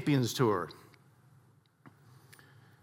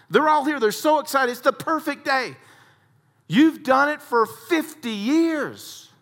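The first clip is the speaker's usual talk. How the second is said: building fervor; 11 semitones higher than usual. Acted. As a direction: preach it up to a peak. Preacher builds to a climax.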